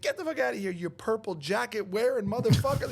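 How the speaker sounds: mock New York tirade; imitated New Yorker. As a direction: mimic a cursing New York guy. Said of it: "he sounded in New York".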